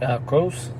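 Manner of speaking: softly imitated four-year-old, slurring the name; soft, unclear little-kid answer; mumbling small kid. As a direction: lightly imitate a tiny kid who can't talk well. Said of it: "like that".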